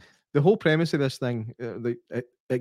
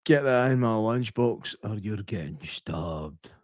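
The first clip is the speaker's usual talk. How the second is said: put-on menacing kid; 4 semitones lower, slower than usual. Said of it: "what he's saying is like".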